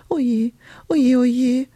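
crying. A narrator's reading. Lost witch alone in the woods, crying. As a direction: wail it as the tears come. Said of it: "Hulda weinte wieder".

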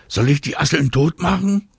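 lightly voiced boy asking; eager question. A narrator's reading. Boy offers to kill woodlice.

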